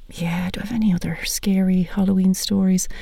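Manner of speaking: whispered like telling a ghost story; a playful spooky whisper to the listeners. Pretend. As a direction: hush it down to a creepy whisper. Spooky setup for Halloween stories.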